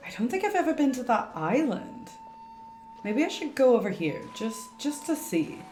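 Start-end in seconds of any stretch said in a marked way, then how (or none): none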